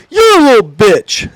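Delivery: high, loud, drawn-out jeer; retold taunting insult. Trainer taunting trainee from the front, mock jeering. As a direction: stretch the insult out, imitating the trainer.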